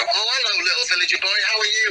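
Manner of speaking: sweet hammed-up panto hello; panto villager greeting. As the panto star greeting the little lad on stage, sing-song. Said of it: "got my little lad to walk on and go".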